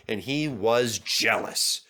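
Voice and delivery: plain reading voice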